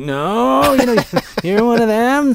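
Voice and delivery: high, loud mock pleading with a laugh; Alan Rickman's American act. Podcaster mimics the villain's American act, high and hammed-up. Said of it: "when he comes to the American".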